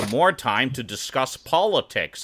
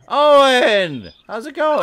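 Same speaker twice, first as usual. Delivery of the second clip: much higher, loud cheery hello; excited greeting; 12 semitones higher, 7 dB louder than usual. Real, not acted. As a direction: call out a big happy welcome. Greeting arriving guest loudly.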